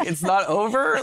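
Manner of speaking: he can't believe it isn't over; stunned disbelief. Retold or imitated. Writer hearing the show continues, shocked and high. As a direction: pitch jumps high and loud in shock.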